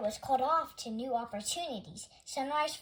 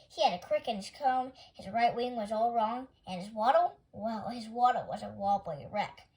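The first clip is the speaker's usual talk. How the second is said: plain reading voice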